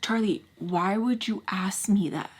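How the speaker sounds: slow, hushed, breath-only question; shocked whisper. Retold or imitated. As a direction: whisper it slowly in disbelief. Shocked reply to stepdad.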